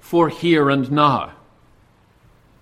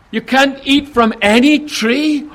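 higher, louder feigned disbelief; Satan's mock question; 7 semitones higher, 5 dB louder than usual. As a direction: act shocked at the rule. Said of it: "Satan says".

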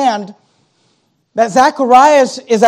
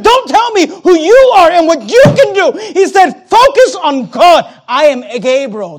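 higher, louder preaching shout; loud rebuke; 7 semitones higher, 6 dB louder than usual. Acted. Gabriel rebuking Zacharias for doubting, shouting. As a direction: thunder it at a doubter.

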